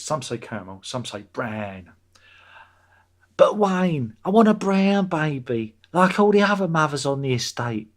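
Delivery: lightly done Waynetta voice; Waynetta Slob. Retold or imitated. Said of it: "That's the Slobs".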